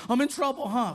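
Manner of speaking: high, loud mock kid voice; worried boy. Son fearing stepdad's scolding, worried and high. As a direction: blurt it out like a kid expecting punishment.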